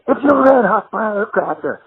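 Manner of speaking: high, startled sleep-talking outburst; imitated high-pitched stage cry; sudden blurt. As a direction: blurt it out like waking mid-show. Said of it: "I just blurt it out".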